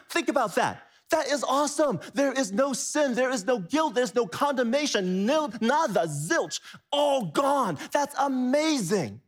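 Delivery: high, thrilled preaching; excited. Real, not acted. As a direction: marvel at the good news. Preacher marvels at forgiveness.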